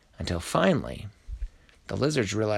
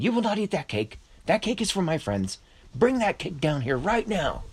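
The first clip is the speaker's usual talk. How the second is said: high, insistent scolding; high-pitched, emphatic order; indignant command; 10 semitones higher than usual. A narrator's reading. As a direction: forbid it firmly in a raised pitch. Astro forbids eating the cake.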